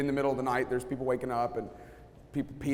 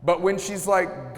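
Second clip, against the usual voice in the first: usual speaking voice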